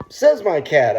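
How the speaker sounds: quoting one's own tagline emphatically; catchphrase demo. Shown as a demonstration. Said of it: "is my original phrase when I go".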